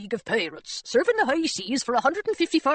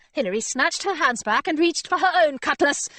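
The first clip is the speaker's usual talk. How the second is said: ordinary delivery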